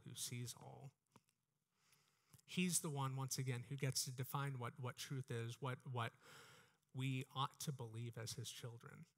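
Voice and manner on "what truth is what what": usual speaking voice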